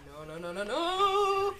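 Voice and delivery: rapid run of nos, swelling loud; panicked nos. Real, not acted. Gamer seeing trouble coming, panicked rising nos.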